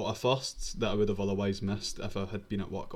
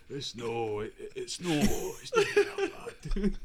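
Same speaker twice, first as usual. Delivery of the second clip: put-on croaky old man, dragging it out; 3 semitones higher, slower than usual. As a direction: do a creaky old geezer. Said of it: "you sounded like [name] when he's doing his old man voice".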